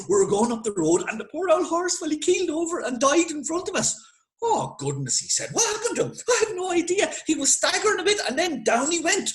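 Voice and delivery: high, breathless rush of bad news; frantic report. Young officer bursting in breathless about the dead horse. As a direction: pitch climbing high, panicked.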